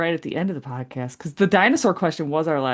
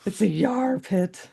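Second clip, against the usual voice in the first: pirate voice; 4 semitones higher, slower than usual. Pretend. Joking about pirate mosh pits.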